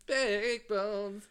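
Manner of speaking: high, loud breathy sign-off; sultry narrator. Acted. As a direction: purr the brand name like an ad. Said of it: "my soft and sultry voice".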